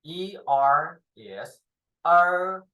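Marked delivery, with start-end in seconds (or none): 2.1-2.6 er with the tongue curled back. Shown as a demonstration. Teacher shows the curled-tongue er to the class. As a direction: curl the tongue up for er. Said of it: "twist up your tongue".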